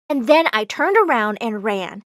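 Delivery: ordinary delivery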